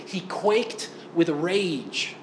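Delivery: very slow, each word placed apart; slow weighty reading. Acted. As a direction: drag it out to let the rage land.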